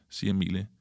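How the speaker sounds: plain reading voice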